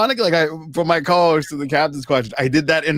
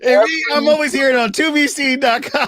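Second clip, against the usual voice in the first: much higher, hammy sales pitch; ad plug voice; 9 semitones higher than usual. Pretend. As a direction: sell it like a cheesy ad.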